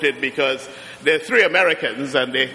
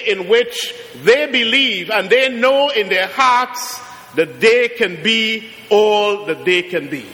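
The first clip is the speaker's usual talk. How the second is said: preaching; 5 semitones higher than usual. Acted. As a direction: stretch the words out like a blessing. Preaching on unshackled children.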